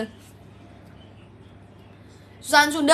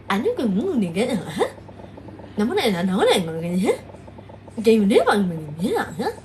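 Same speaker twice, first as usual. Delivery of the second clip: mock Sundanese; 7 semitones lower, 3 dB quieter than usual. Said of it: "gitu loh, kayak".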